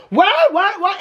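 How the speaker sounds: hammed-up disbelief, squealed upward; mock shocked parents. Imitating Robin and Cody's reaction, mock shocked squeal. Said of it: "it's like Robin and Cody are like".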